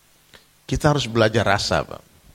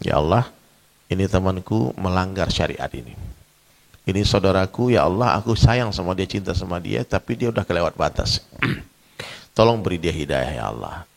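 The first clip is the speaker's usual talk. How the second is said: a hushed, pleading prayer to God, softer and lower; tender whispered supplication; humble prayer; 6 semitones lower, 3 dB quieter than usual. Retold or imitated. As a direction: pray quietly for a friend you love. Voiced prayer for a sinning friend.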